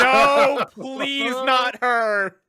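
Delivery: high, loud desperate plea; pleading dismay. Acted. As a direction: beg in panic, voice up high. Pleading in character.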